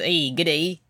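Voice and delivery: badly faked Australian greeting; Colin Mockery. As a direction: do a bad Australian impression. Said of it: "Colin Mockery's bad Australian impression on Whose Line".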